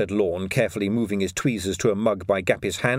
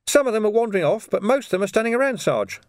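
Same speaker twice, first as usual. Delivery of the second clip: put-on young constable voice; 12 semitones higher, 3 dB louder than usual. Young constable Sam speaking.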